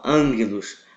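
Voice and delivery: ângelos with an N sound before the double gamma, ang-ge-los; Greek angelos pronunciation. Shown as a demonstration. Teacher to class, showing the Greek reading of ângelos. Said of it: "é dessa forma".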